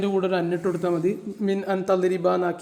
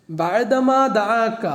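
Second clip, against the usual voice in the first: drawn out; 3 semitones higher, 3 dB louder, much slower than usual. Shown as a demonstration. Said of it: "teacher stretches the verse words".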